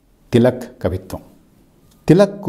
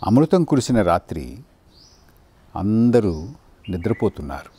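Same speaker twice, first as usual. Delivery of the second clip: measured poem reading with long pauses between phrases; unhurried verse, spaced out with silences; poetry recitation; 2 semitones lower, 4 dB quieter than usual. Acted.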